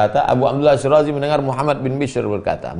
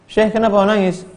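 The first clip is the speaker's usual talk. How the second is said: puzzled questioning; 10 semitones higher than usual. Acted. Disciple questions weeping sheikh.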